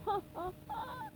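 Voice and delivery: soft high greedy chuckle; chuckling laugh. Acted. Mr Krabs chuckling at the money machine. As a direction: laugh with glee over money. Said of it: "he said, chuckling".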